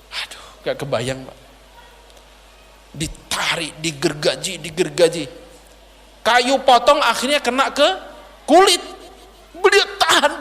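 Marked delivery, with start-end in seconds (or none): 0.1-5.2 hushed grief. Real, not acted. Preacher tells the congregation of the sawing in a hushed voice. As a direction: lower the voice in awe and grief.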